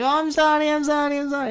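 put-on high squeaky young boy voice, eager and loud; squeaky kid gamer. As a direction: play an overexcited twelve-year-old fan. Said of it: "it's fucking screeching through my ears".

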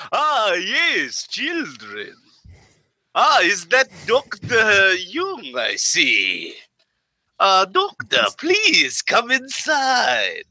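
high, slow, eerie host calling out; spooky adult voice. Acted. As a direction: greet the guests like a haunted mansion host. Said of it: "spooky adult voice".

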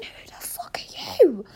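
slow, whispery disbelief; baffled inner thought. Acted. Blind woman wondering who just shouted, slow baffled whisper. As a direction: think it aloud in a drawn-out whisper. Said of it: "And I was thinking".

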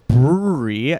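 BREWERY slurred into "brurry"; "Brewery" with its middle squashed together; tongue-tied pronunciation. Real, not acted. Host stumbling over the venue's name, tongue-tied. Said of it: "really hard word for me to say".

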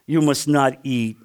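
a hint of the tempting serpent, dropping low; imitation of Satan. Preacher voicing Satan's question to the congregation, low and sly. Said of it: "That's my imitation of Satan".

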